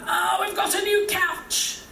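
high, slow, proud announcement; boastful delight. Acted. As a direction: show it off in a high drawn-out voice. Uncle showing off his new purchase.

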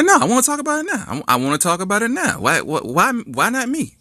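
high, fast insistent protest; high frantic refusal; upset girlfriend. Acted. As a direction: demand it shrill and hurt.

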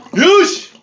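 Yush barked out high and loud; mock Russian shout. Mocking a friend's toast as a Russian guy, loud. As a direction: shout the word like a brash tough guy. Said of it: "Is that, like, some Russian guy you know?".

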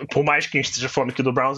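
usual speaking voice

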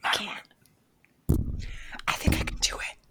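whispered with a giggle; giggly prank whisper. Pretend. Keeps whispering into the mic.

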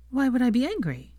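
a lightly voiced caring girlfriend; Hermione's voice. A narrator's reading.

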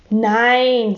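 one curt word; taciturn. A narrator's reading. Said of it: "sagten die beiden wortkarg".

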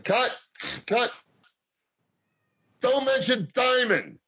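high, slow cut it out order; imitated boss shouting cut; mock yelling boss. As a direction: bark the stop like an angry boss. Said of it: "It's Vern Gagne yelling at you".

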